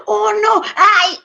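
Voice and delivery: husband finding the ruined pot, high and panicky; panicked. Acted. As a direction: gasp out in dismay. Finds burnt pot.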